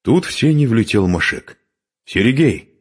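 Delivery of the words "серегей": raised, higher call. Acted. Man bursting in and calling his friend, calling out. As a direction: hail a friend by name.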